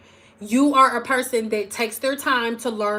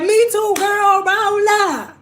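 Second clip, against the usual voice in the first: high and very slow, sassy invitation; eager girlfriend voice; 9 semitones higher, 6 dB louder, slower than usual. Retold or imitated. Streamer voicing a viewer who wants to roll out, high and slow. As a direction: drag it out like a hyped friend calling you over.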